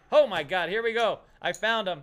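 loud, thrilled outburst; excited. Real, not acted. Host finds the lost checks under the keyboard, excited shout. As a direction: burst out with relief at the find.

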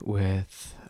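soft, slow embarrassed confession; sheepish and quiet. Real, not acted. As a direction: admit it under your breath.

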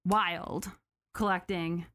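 usual speaking voice

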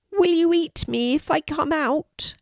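high, small dragon voice; timid little dragon. A narrator's reading. Tiny dragon asks from hiding, timid high voice. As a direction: pipe up high, a touch worried.

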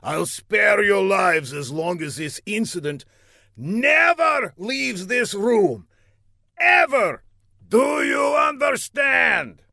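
high, loud threat, slowing for emphasis; furious villain. A narrator's reading. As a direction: rant building to high, slow shouts.

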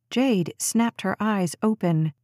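ordinary delivery

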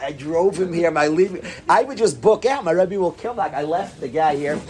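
usual speaking voice

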